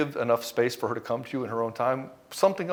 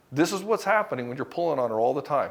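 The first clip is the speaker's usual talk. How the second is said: normal talking voice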